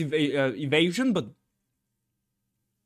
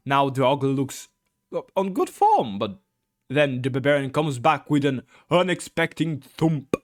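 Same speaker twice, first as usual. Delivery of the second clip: ordinary talk, nothing marked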